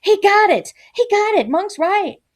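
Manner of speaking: loud, high excited scream. A narrator's reading. Tuba player runs down the hall screaming the news. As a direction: yell it down the hallway.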